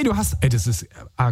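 normal talking voice

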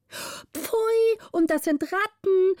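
very high, shrill chorus of pages crying out; pages shrieking in disgust. A narrator's reading. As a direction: squeal it like disgusted pageboys. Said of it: "riefen alle Pagen mit großem Geschrei". (Pagen kreischen angewidert sehr hoch)

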